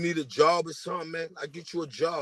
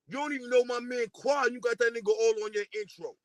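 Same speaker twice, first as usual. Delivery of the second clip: ordinary delivery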